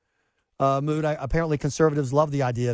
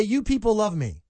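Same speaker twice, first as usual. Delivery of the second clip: Trump impression; 6 semitones higher than usual. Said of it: "As Donald Trump would say".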